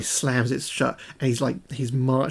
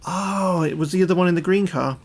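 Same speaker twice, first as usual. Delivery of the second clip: put-on higher-pitched kid's realisation; imitating a child; 5 semitones higher than usual. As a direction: pipe up like a child working it out. Voicing child in imagined exchange.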